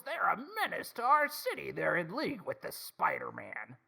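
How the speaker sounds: high-pitched ranting newsman impression; mock outrage, slowing on the last phrase; J. Jonah Jameson. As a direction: rant an alarm to the city, high and far from your own voice. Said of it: "J. Jonah Jameson would be like".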